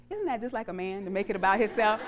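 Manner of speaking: usual speaking voice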